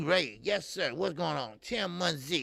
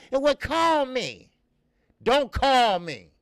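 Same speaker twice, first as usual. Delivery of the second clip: dismissive; 6 semitones higher, 6 dB louder, slower than usual. Pretend. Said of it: "That's what I would've said".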